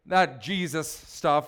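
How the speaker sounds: "Jesus" drawn out slowly and set apart; stress on Jesus. Retold or imitated. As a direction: lean hard and slow on "Jesus". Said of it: "he emphasized the word Jesus".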